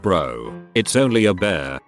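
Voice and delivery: plain reading voice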